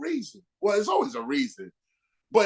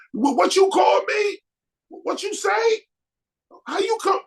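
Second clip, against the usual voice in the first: mock affronted snap back; angry challenge; 12 semitones higher than usual. Difficult person confronting someone, high indignant shout.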